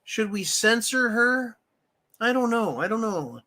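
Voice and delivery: a hint of feigned helpless uncertainty; mock dithering. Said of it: "Congress is sitting there going".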